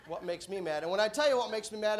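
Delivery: ordinary delivery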